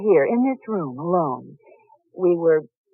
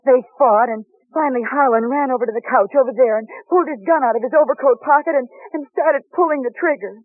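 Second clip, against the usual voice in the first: agitated retelling; 6 semitones higher, 4 dB louder than usual. Acted. Upset widow recounts the shooting.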